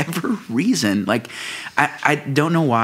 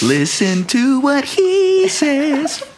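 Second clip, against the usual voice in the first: playful drawn-out; 12 semitones higher, much slower than usual. Pretend. Playful slow announcement.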